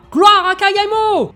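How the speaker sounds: very high, loud triumphant shout; rallying cry. Acted. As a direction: roar the salute to the leader. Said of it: "Et Nagai qui lève le bras".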